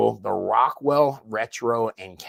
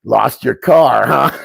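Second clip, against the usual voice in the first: mocking call-out; 12 dB louder, slower than usual. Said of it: "And I'm like".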